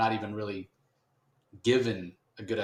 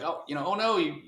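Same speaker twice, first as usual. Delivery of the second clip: upset friends; 6 semitones higher than usual. Retold or imitated. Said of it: "Spawn's friends are like".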